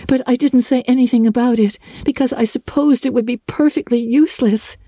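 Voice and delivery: put-on high, rushing girl's voice; eager girl. A narrator's reading. Anne confiding her dream to Marilla, high and eager. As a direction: pour out a secret dream.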